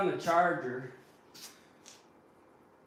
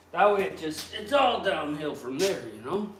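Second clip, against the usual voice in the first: normal talking voice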